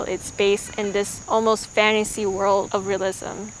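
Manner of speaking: usual speaking voice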